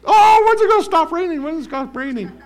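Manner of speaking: very high, loud whining voice; mock whiny complainer. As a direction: squeal out the complaint.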